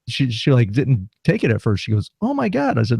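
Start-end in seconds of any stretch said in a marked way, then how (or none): 2.2-2.8 high-pitched mock shock; imitated startled girl. Drive-through girl seeing an orange hand, high mock shock. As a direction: imitate a girl's high gasp of disbelief. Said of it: "this girl goes".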